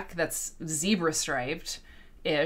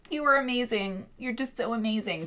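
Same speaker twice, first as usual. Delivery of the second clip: higher, hammed-up gushing; insincere praise; 6 semitones higher than usual. Imitating people who frown while complimenting, falsely admiring. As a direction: praise through a frown, not meaning it. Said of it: "they're frowning while they're saying it".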